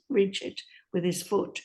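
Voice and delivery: ordinary delivery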